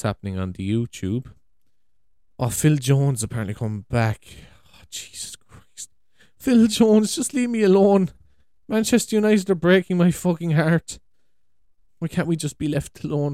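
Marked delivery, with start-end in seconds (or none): 4.7-5.9 breathed out slow and unvoiced under his breath; exasperated whisper. Real, not acted. Vlogger groaning to viewers about football news, whispered. Reacts to Phil Jones news. 6.4-13.3 higher and louder despairing rant; exasperated outburst. Real, not acted. Manchester United fan despairing at Phil Jones news, loud exasperated rant.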